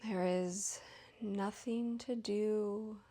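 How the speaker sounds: unhurried, even meditation voice, lingering between phrases; guided meditation. Real, not acted. Leads guided yoga nidra.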